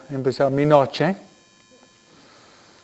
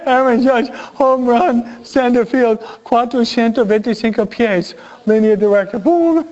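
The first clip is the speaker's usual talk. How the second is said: higher, louder excited announcer call; play-by-play call; 8 semitones higher, 3 dB louder than usual. Retold or imitated. Priest calls Aaron Judge's homer like a radio announcer, excited. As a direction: call the home run like a sportscaster. Imitates home run call.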